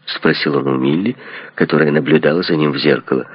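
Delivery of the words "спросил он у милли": usual speaking voice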